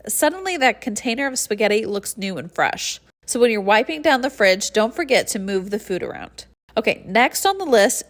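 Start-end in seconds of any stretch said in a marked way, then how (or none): none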